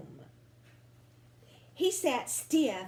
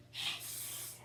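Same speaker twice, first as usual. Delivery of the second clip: whispered hiss; 12 dB quieter than usual. A narrator's reading. Narrated paint box hissing.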